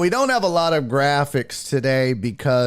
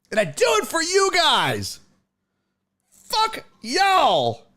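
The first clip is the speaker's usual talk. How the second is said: high, fast, fired-up tirade; venting at chat that nobody values his work; frustrated rant. Real, not acted. Streamer ranting at chat about his code, fired up.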